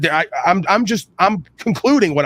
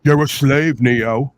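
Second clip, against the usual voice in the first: Matrix quote; 4 semitones lower, slower than usual. Retold or imitated. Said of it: "the first line of the Matrix".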